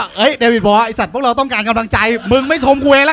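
a comic mock-yelling at a colleague who withheld praise; mock outraged retort. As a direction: rant at him in hammed-up outrage.

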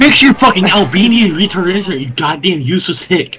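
sudden aggression. Real, not acted. Berating Jeff for his lost connection, sudden aggression. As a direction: lay into him with abuse. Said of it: "The aggression".

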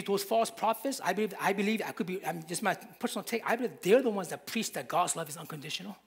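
usual speaking voice

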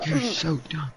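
whispering. Real, not acted. Teasing whisper after a gasp.

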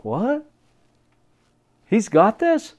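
puzzled, rising double take; mock disbelief. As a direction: imitate baffled onlookers. Astonished co-workers are imitated.

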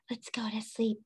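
unvoiced hushed whisper; teacher hushing children for sleep. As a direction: whisper softly to settle them. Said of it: "pretend sleep game".

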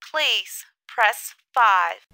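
normal talking voice

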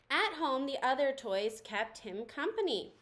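ordinary delivery

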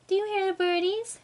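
high, bright coo to her cat; sing-song baby voice asking the cat to listen; baby talk to pet. Acted. Owner coos to her cat about birdies, high baby talk.

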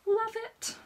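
love it, squeaked up high and loud; a sudden high squeal of love; excited squeal. Real, not acted. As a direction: gush with delight. Gushing over a favourite jacket.

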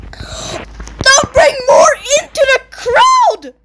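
slow, high, loud plea that cracks; desperate yell with a cracking voice. Real, not acted. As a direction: voice breaking in a panicked shout. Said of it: "I got a little voice crack there".

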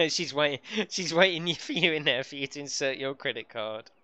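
laughing through the words; giggling while teasing her. Real, not acted. Teasing a game character while laughing.

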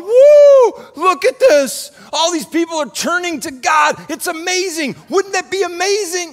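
high, loud, thrilled mock cheer; excited cheering. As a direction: cheer like the whole town just turned to God. Imagined crowd cheering in Medina.

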